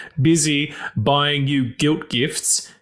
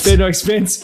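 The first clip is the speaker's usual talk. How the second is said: lightly voiced movie-quote delivery, a touch louder; film quote voice; 5 semitones higher, 5 dB louder than usual. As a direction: say it like the film line. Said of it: "You're supposed to say".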